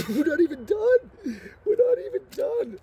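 high-pitched, incredulous excitement; giddy disbelief. Real, not acted. Giddy over box at halfway point.

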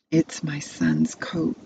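plain reading voice

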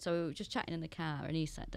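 ordinary talk, nothing marked

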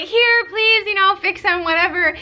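high, loud desperate plea; retold panic at the vet desk, high and loud; mock frantic pleading.

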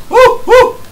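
high loud whooping; cheering. Real, not acted. Cheering his friend during the boss fight. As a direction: whoop him on to the win.

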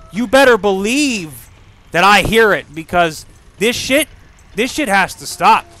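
loud, insistent rant; emphatic vow. Real, not acted. Streamer vowing to viewers to quick save, loud and emphatic. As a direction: hammer it out loudly.

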